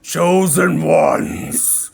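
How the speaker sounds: slow, loud booming roar; booming monster voice. Acted. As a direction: bellow it like a huge beast. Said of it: "in a large, booming voice".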